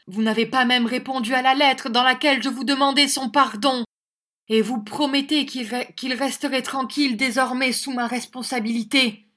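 high, loud sustained reproach; indignant accusation. A narrator's reading. Young teacher justifying his intervention to the schoolmaster, indignant accusation. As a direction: press the charges home, loudest at the end.

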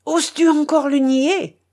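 loud, high, outraged challenge; furious. A narrator's reading. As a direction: raise the voice in fury at his nerve. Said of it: "dit le contre-amiral en fureur". (avec fureur, voix forte et haute)

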